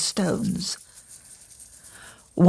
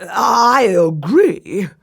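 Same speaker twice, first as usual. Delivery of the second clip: slow, unsure whispered agreement; hesitant emperor giving in; 4 dB louder, much slower than usual. Acted. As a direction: agree reluctantly, dragging it out. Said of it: "the slightly hesitant Emperor".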